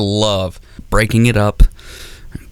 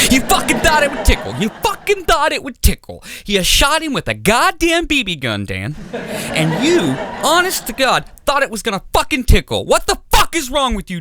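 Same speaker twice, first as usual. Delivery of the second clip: very high, mock-incredulous yelling; mock-furious dad rant. Dad berating son Dan, high mock fury. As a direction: rant like a disbelieving, raging father. Dad ranting in acted quarrel.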